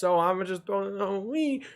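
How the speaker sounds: soft, rising to a high little "wee"; mock carefree. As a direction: imitate someone blithely gliding off. Mimics a careless jumper.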